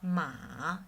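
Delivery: MA dipping low, then rising; third tone. Shown as a demonstration. Teacher practices the third tone, dipping. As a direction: sink "ma" deep, then lift it. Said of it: "Third one".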